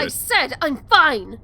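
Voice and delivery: angry snap. Acted. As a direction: snap at him to back off. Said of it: "I didn't mean to snap".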